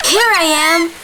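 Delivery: high gleeful call from the trees; monkey calling down to his uncles; cheeky shout. Acted. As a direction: pop up and shout with glee. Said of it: "shouted Bandar once more".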